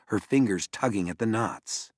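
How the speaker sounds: plain reading voice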